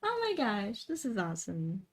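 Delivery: normal talking voice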